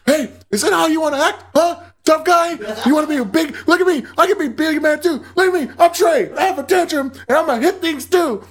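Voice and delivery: high-pitched mocking taunt; Diego. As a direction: goad him in a high, childish tantrum voice. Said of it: "This is what Diego does".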